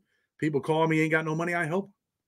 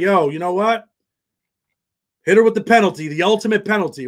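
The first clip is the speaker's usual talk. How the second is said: louder, higher, a hammed-up loud call for punishment; mock shouted demand; 4 semitones higher, 7 dB louder than usual. Retelling how people online call loudly for her execution. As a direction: bark it like a crowd demanding blood. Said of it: "They're like".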